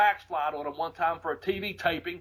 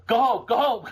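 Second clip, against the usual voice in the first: retold yelling; 7 semitones higher, 5 dB louder than usual. Buddy yelling at him in ring.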